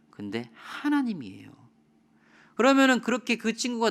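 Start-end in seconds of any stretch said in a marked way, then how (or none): 0.1-1.5 whisper. Real, not acted. Dramatic reveal in illustration.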